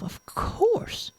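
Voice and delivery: breathy whisper to herself; whispered thought. Acted. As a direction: let the idea slip out under breath. Jane's private thought.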